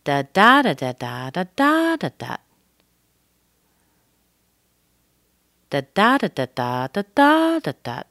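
sing-song "da" pattern, stressed "da" jumps up; intonation on da-da's. Shown as a demonstration. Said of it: "do the whole thing together with da, da's".

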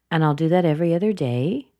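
quick, with "day" turning up; unfinished upturn on "day"; rising intonation. Shown as a demonstration. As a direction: lift "day" to keep going. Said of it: "She's continuing on".

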